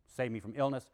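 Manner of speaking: ordinary talk, nothing marked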